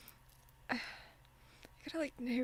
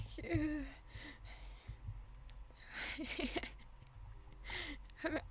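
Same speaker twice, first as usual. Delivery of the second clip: helpless silent laughter; shaking with quiet laughter, can't stop; breathless giggle; 5 dB quieter than usual. Real, not acted. Streamer cracking up mid-sentence, breathless giggle.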